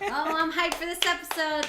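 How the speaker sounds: high, loud hyped cheer. Retold or imitated. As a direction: pump yourself up for it. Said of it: "it always got me so hyped".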